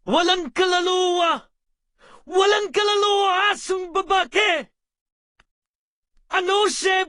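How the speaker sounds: stern, shouted scolding; harsh telling-off at full voice; angry scolding. Acted. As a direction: scold forcefully, hammering out each order.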